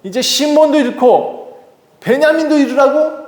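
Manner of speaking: the father refusing to lose another son, in a high angry voice; angry outburst. Acted. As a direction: refuse them, voice climbing high in anger. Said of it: "화를 내면서".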